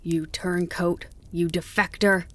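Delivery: ordinary delivery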